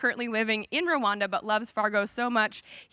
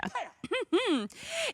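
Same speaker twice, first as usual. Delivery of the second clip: light laugh; 12 semitones higher, 4 dB quieter than usual. Real, not acted. Host laughs after pun.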